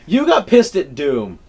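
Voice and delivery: loud, slow jab at a friend; loud teasing. Real, not acted. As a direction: call him out loudly and slowly. Teasing friend about rage.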